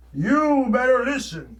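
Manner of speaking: slow, booming voice from the sky; thunderous voice. Retold or imitated. God warning people from the sky, slow booming command. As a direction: boom it down like God from the heavens. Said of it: "this thunderous voice".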